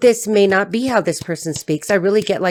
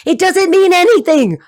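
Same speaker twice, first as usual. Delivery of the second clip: loud, high-pitched protest; raised, insistent exclamation; exasperated outburst; 11 semitones higher, 7 dB louder than usual. Real, not acted. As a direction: burst out in frustration. Outburst while defending separate rooms.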